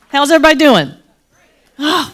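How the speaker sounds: ordinary talk, nothing marked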